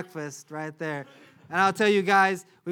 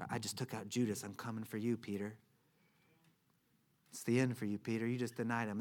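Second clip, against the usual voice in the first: low, hushed tempter's voice; soft, low menace, lying to him; taunting enemy; 6 semitones lower, 8 dB quieter than usual. Acted. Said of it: "the enemy coming and beginning to lie to him".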